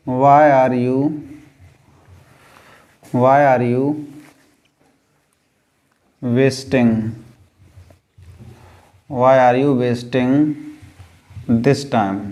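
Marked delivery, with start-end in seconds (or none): none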